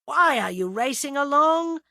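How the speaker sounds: high, loud plaintive demand. A narrator's reading. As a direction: complain as you trail behind. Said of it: "demanded James plaintively".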